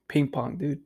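ordinary talk, nothing marked

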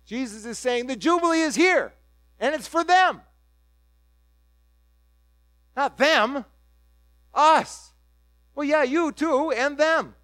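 preacher pressing the point, building; preaching at full force. Real, not acted. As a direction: hammer home who it is for. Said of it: "preaching climax".

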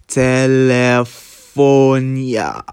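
Telefonia sounded out slowly syllable by syllable; sounding it out. Shown as a demonstration. Reading Cyrillic phone book aloud, slow sounding out. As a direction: spell out Telefonia slowly, letter by letter. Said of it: "I was, like, sounding it out".

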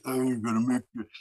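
lightly voiced underbite thug. Shown as a demonstration. Narrator shows his go-to thug voice, lightly voiced underbite thug. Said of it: "that's, like, my go-to for thugs".

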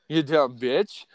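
usual speaking voice

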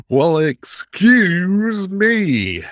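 high, very slow huffy "well excuse me"; mock indignant. Mock offended reply.

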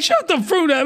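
high, sharp dismissive line; hammed-up tough retort; mock tough guy. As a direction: cut her off hard. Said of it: "And he's like".

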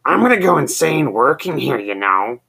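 high, loud put-on grumble; mock frazzled complaint; exasperated coworker. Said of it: "Dick said".

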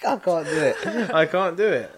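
high, helpless laughing protest. Real, not acted. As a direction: give up on it through real laughter. Cracking up at failed accent.

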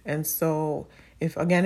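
ordinary delivery

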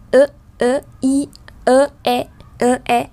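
"ơ, i, ơ, e" as separate exercise vowels with air in the nose; luyện âm mũi. Shown as a demonstration. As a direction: shift from "ơ" to "i" and "e", sending breath to the nose. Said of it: "Luồng hơi nó sẽ dịch chuyển ở trong mũi". (đọc mẫu "ơ, i, ơ, e" dồn hơi lên mũi)

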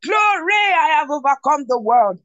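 high, exultant cry on each "Glory"; joyous shout. Shown as a demonstration. Preacher shows believers what their shout should be, joyous and high. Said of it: "Your shout should be".

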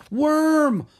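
loud, high alarmed shout of a name; yelling. Acted. Anna yells at Worm as lightning hits him, loud and high. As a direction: scream his name in alarm. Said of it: "Anna yelled".

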